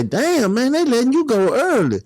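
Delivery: a high, different voice for the cellmate; mildly acted surprised cellmate. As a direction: sound amazed at the early release. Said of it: "They say".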